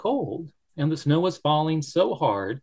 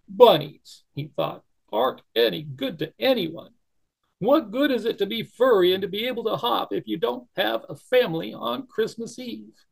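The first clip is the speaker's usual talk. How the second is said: higher, despairing bunny voice; sad little bunny; 9 semitones higher, 3 dB louder than usual. Acted. Barrington despairs alone in the snow, high and sad. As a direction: lament being useless.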